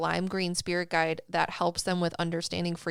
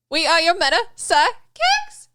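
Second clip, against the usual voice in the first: higher, louder announcer slogan; announcer sign-off; 10 semitones higher, 10 dB louder than usual. Acted. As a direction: sell the show tagline with punch.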